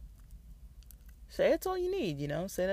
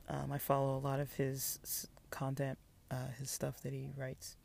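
whispering; 7 dB quieter than usual. Real, not acted. Aside while reading page.

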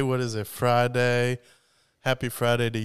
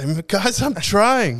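higher, louder whiny pleading; mock whiny plea; 9 semitones higher, 7 dB louder, slower than usual. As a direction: whine it like someone begging friends to understand.

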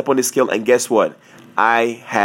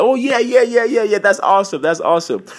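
faked enthusiasm; 8 semitones higher than usual. Wrestler hiding shock from coach on phone, falsely upbeat. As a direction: cover shock with over-eager, high-pitched agreement. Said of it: "didn't let him know how shocked".

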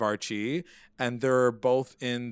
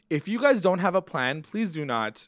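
louder, higher exasperated plea; pleading; 6 semitones higher, 5 dB louder than usual. Retold or imitated. Podcaster pleading with the characters, mock exasperated. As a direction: beg them not to provoke anyone. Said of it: "And I was like".

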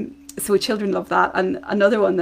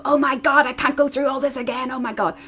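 mock exasperated vent, a touch higher; 6 semitones higher than usual. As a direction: sigh it out to a friend, half-joking. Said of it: "message them before bed and go".

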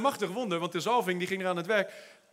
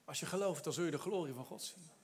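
soft echo; 5 semitones lower, 13 dB quieter than usual. Shown as a demonstration. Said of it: "repeats promise softly to congregation".

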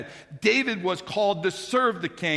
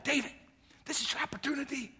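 hushed urging; 8 semitones higher, 7 dB quieter than usual. Retold or imitated. Men urging David in cave.

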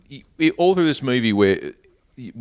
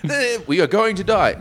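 mock panic; 10 semitones higher than usual.

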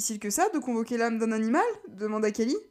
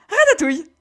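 crié fort; 8 semitones higher, 12 dB louder than usual. Acted. Réponse criée à Stacy.

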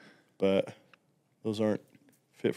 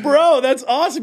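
excited; 6 dB louder than usual. Retold or imitated. Delighted reaction.

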